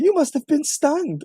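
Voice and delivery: mock interviewer. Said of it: "Barbara Walters trying to".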